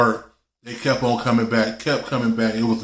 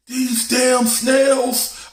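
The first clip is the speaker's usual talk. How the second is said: high, slow, drawn-out yell of the snails; dad bellowing at the tank; mock dad yell; 12 semitones higher, much slower than usual. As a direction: stretch it out in a high howl. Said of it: "My dad was yelling".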